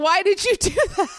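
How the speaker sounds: hammed-up bewildered boyfriend; imitating Howard. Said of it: "And he's like".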